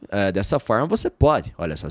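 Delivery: usual speaking voice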